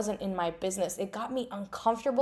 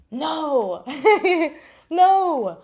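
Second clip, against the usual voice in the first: laughing no; 7 semitones higher, 8 dB louder than usual. Real, not acted. Emphatic rejection of "I lost money.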